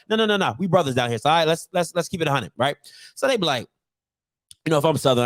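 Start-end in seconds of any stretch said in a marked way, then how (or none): none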